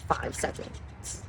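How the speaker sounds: whisper. Real, not acted. Counting time under her breath.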